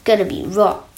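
plain reading voice

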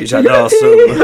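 laughing it out, high and loud. Real, not acted. Host cracking up about the wrestlers, laughing high. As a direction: gush through a high laugh. Cracking up over Team Bad.

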